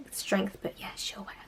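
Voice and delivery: trailing sarcastic whisper; dismissive whisper. Real, not acted. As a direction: let it fade to a whatever whisper. Muttered dismissive aside.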